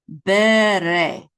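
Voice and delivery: "bere" drawn out, each syllable held. Shown as a demonstration. Teacher blends syllables for learners, slow and loud. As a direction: stretch "bere" slowly and loudly for learners. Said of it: "Go ahead and say that".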